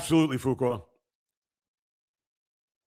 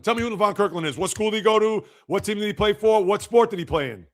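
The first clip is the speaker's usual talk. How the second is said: ordinary delivery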